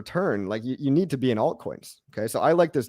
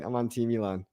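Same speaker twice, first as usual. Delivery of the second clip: quiet repeat; 7 dB quieter than usual. Real, not acted.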